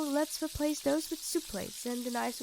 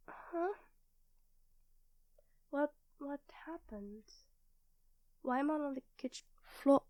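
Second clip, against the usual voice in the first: softer, groggy confusion; dazed; 8 dB quieter than usual. Acted. Waking on the kitchen floor in her partner's arms, soft and dazed.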